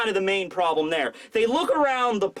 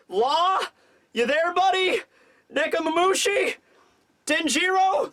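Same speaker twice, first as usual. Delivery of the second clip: higher, questioning calls into a dead line; hesitant, rising hails, pausing for any answer; increasingly worried caller; 6 semitones higher than usual. Retold or imitated. Kinemon trying ally after ally on the snail, worry building. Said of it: "he starts to freak out as he's talking".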